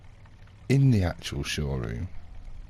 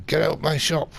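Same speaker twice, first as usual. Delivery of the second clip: loud, high mock-German order; mock German accent; 12 semitones higher, 6 dB louder than usual. German dealer kicking the customer out, loud mock accent. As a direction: imitate an angry German shopkeeper. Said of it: "In a German accent, obviously".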